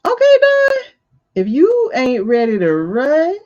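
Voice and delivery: high, loud jeering call to Don; mock taunt. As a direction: taunt him like a heckler. Mocking a candidate in a put-on voice.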